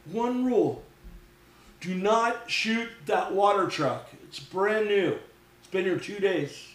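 high-pitched, emphatic retold warning; stern rule-giver. Coop guarding brand new water truck, emphatic warning. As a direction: imitate a host spelling out the law.

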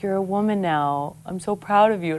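soft, tender dad voice; a lightly voiced proud father. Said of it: "he's like".

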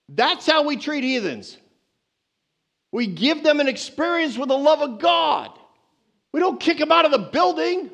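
rising preaching. Real, not acted. Preacher building to emphatic point.

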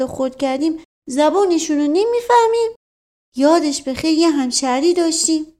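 high, emphatic boasting; protesting servant. A narrator's reading.

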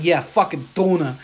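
slow mock Boston accent; Boston accent, imitated. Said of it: "a guy walking in Boston".

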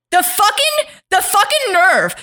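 hammed-up fury rising to a shriek; mock outraged rant. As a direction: rant like an offended prince. [name] voiced ranting.

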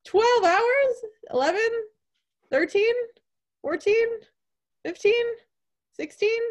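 high, loud escalating guesses; teasing guesses. Real, not acted. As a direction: keep upping the number in disbelief. Guessing screen time, teasing.

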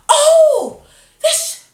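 very high, loud, squealed concern; hammed-up shriek of worry, stretched out; mock alarm. Lady in the back recognizing the fainting customer, mock alarm.

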